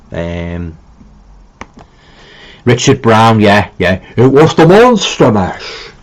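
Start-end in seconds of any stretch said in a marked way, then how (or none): 4.2-5.9 slow, higher-pitched hammy horror-actor impression; Boris Karloff. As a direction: stretch it out like a theatrical horror star. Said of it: "the awful Boris Karloff impersonations".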